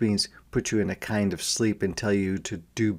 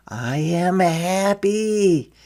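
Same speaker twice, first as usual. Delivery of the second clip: happy and tender; 10 semitones higher, 7 dB louder, much slower than usual. A narrator's reading. Joyful turn in story.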